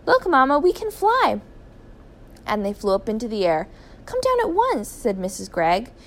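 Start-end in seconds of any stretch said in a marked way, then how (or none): 0.0-1.4 put-on high, loud little boy; thrilled, piping child voice; chirping excitement. A narrator's reading. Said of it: "chirped Philip". 4.0-4.9 high, urgent mother's call; worried, pitched-up command; alarmed order. A narrator's reading. Mother orders flying children down.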